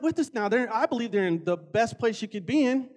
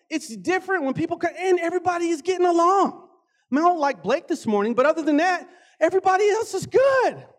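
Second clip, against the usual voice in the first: higher, louder, near a shout; excited; 6 semitones higher, 3 dB louder than usual. Real, not acted. Pastor celebrates the church getting along, loud and high. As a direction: preach it up with joy.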